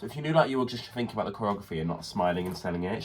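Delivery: normal talking voice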